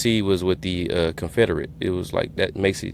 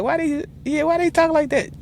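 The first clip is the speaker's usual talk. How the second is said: much higher, mock baffled voice; baffled reaction. Reacting to accents on The Wire, baffled high mock voice. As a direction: play up your own confusion at the accent. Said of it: "I'm like".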